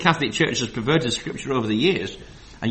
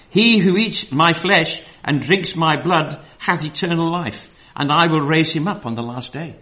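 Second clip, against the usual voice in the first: scripture reading; 3 semitones higher, 5 dB louder than usual. Acted. Reads scripture aloud.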